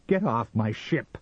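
high, tense command; angry, fearful order. A narrator's reading. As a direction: order the intruder off sharply. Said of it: "undecided between fear and hate".

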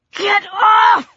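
high, panicked cry; desperate shout. A narrator's reading. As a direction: yell it while struggling to get free. Pinned girl fights off attacker.

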